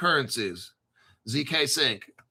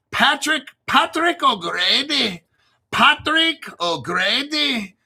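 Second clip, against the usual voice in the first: higher, louder, put-on Irish accent; slow, raised Irish brogue introducing a guest; 7 semitones higher, 5 dB louder than usual. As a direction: hams up an Irish lilt on the name. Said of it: "That's my Irish accent".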